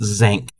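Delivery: ZINC said with a wide "a", "zank"; aunt's pronunciation. Retold or imitated. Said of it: "My aunt pronounces it zinc".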